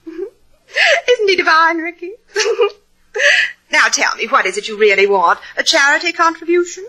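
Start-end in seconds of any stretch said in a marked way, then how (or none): 0.0-2.7 mocking laugh running through the words; amused, fluttery laugh over the line. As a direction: laugh as you say it. Laughs at Slade's quip.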